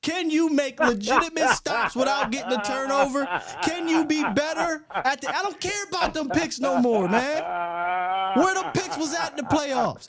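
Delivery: high-pitched, worked-up rant; hyped up. Real, not acted. Host challenging co-host over playoff picks, hyped up. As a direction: fire off heated challenges, voice riding high. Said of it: "I'm getting a little hyped up".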